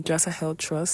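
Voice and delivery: normal talking voice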